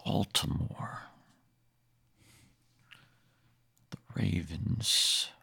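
very soft, slow whisper; hushed whisper. Pretend. Player murmuring the wizard's team as an aside, slow whisper. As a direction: whisper it slowly, barely audible.

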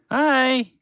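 high, bright-voiced horse greeting; cheery horse. Horse greeting the hiding mouse, high and cheery. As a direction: call out a friendly hello.

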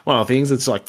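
normal talking voice

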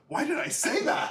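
high, baffled self-questioning; self-doubting. Retold or imitated. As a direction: ask yourself, stunned at your own words. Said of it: "and I'll turn around and be like".

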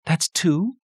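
high-pitched mild surprise; surprised mother, pitch jumping up; fairly surprised. A narrator's reading. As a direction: read out a count you didn't expect. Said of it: "sounded fairly surprised when".

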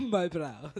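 laughing mock Russian accent. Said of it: "I like my Russian accent".

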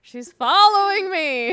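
high, slow mock whine; mock whiny complaint. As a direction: whine it like a pestered victim. Mock complaint about being followed.

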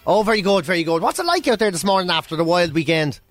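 ordinary delivery

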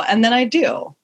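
ordinary delivery

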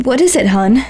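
understated nervous squeak. A narrator's reading. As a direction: let the pitch lift into a mild squeak. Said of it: "I squeaked".

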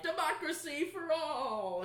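slow, high, grandiose announcement; mock grand proclamation. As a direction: proclaim it like a cheesy slogan. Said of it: "is just gonna be like".

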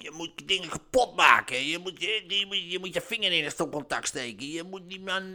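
a slightly distant, pushy voice giving orders; commanding inner voice. Retold or imitated. Inner voice ordering the speaker to break things, in a lightly put-on pushy tone. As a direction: voice it like a sinister voice in your head urging you on. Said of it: "stemmen hoort die zeggen".

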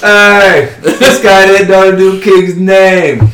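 loud, high, drawn-out shout; calling out. Acted. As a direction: announce it to the whole crowd at full voice. Player calls out to the crowd.

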